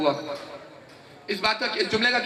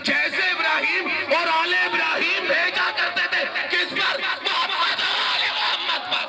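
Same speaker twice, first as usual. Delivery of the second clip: fiery preaching; 8 semitones higher than usual. Real, not acted. Preacher proclaiming the meaning to the congregation, fiery raised preaching. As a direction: drive the point home to the crowd.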